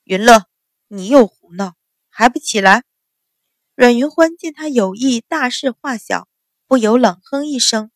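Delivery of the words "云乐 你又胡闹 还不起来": loud stern bark; father scolds his younger daughter, loud; scolding shout. A narrator's reading. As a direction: shout down at the child. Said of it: "向小女儿喝道".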